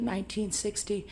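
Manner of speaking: ordinary talk, nothing marked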